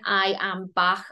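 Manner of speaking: ordinary talk, nothing marked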